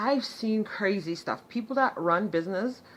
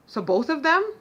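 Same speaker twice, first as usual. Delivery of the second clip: mock question; 9 semitones higher, 5 dB louder than usual. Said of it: "And he goes".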